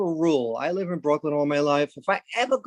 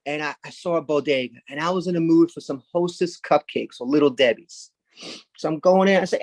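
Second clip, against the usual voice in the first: ordinary talk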